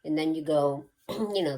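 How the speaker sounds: normal talking voice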